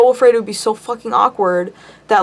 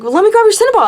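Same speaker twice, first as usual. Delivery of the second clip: high, loud chirpy offer; overly bright eager offer; 11 semitones higher, 4 dB louder, much faster than usual. Retold or imitated. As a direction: pitch it up eagerly.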